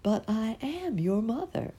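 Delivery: tender insistence. A narrator's reading. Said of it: "said the beautiful creature".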